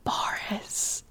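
hushed, whispered reveal; whispered aside. Acted. Reading the bracketed name in a listener's story, whispered aside.

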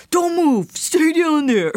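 high, loud hammy tough guy; mock action hero. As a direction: bark it like a bad movie cop. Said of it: "And he's like".

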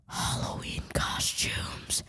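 hushed whispered reveal; whispering. Acted. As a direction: whisper the topic like a secret.

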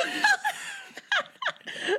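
high, shouted laugh. Real, not acted. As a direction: howl with laughter. Laughing at her own story.

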